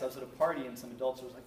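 usual speaking voice

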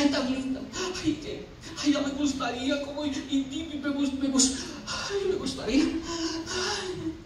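soft, hesitant swooning; wistful admirer. Acted. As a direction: stammer it softly, too timid to act. Acts bashful admirer sighing.

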